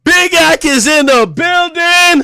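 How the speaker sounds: loud, high, slow holler; hype announcement. Real, not acted. Streamer opening his stream, loud drawn-out hype holler. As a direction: belt it out like an arena entrance.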